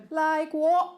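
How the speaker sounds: high, loud fake British accent. New classmate answering British students, in a fake British accent. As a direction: feign innocence in a British accent. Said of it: "need to speak with a British accent".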